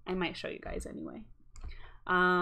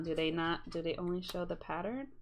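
ordinary delivery

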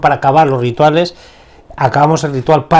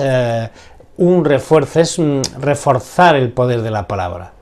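usual speaking voice

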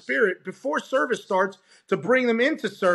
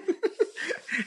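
short laugh; 8 semitones higher, 5 dB quieter than usual. Real, not acted. Laughs at own surprise.

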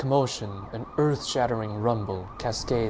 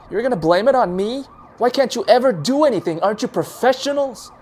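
high, loud, fast indignation; indignant; 12 semitones higher, 6 dB louder, faster than usual. A narrator's reading. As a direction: throw the blame back. Doc argues with officer.

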